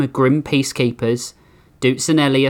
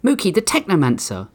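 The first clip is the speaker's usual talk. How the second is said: horrified; 7 semitones higher than usual. Acted. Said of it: "turned to Mookie in horror".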